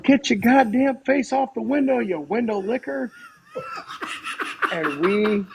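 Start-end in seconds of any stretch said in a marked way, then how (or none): 0.0-3.1 retold outburst in a high yell; Joel. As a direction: imitate a sudden high-pitched holler. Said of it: "Joel yells".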